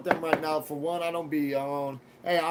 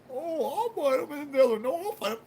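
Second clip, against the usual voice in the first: much higher mocking whiny voice; mocking the brothers; 10 semitones higher than usual. Mocking the brothers who backed out, high whiny voice. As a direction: mimic them high and whiny. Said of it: "them motherfucking brothers".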